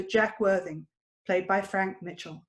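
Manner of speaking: ordinary talk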